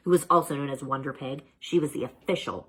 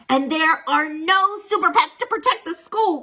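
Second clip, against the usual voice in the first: cried; 13 semitones higher, 9 dB louder than usual. A narrator's reading. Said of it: "cried Wonder Pig with a gasp".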